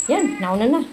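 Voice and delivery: normal talking voice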